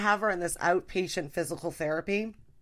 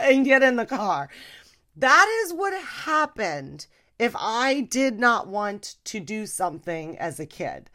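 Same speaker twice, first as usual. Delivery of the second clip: ordinary talk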